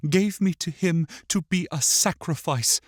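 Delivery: put-on high child's voice, bitterly recounting; wounded, accusing boy. A narrator's reading.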